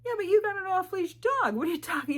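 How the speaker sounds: high, loud mock outburst; incredulous retort. Thinking back at hypocritical dog owner, loudly incredulous. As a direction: shoot back in high-pitched disbelief.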